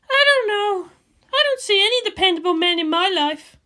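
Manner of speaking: a tense commander on the eve of battle; Misato's voice. Acted. Misato brooding to Ritsuko about tomorrow, high and loud.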